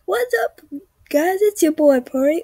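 mock hyped vlogger greeting; YouTuber greeting. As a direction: shout it like an excited channel opener. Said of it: "Just saying".

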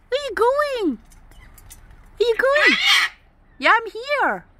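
usual speaking voice

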